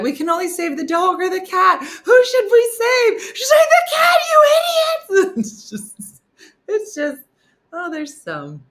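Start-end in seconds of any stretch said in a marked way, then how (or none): 0.0-3.2 high, loud, dragged-out question; high loud mock urgency; urgent question. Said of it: "they were like". 3.7-5.4 high furious yell, slowed; high screaming mock fury; angry shout. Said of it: "she was so mad".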